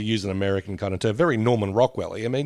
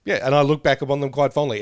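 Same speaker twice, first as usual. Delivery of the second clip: usual speaking voice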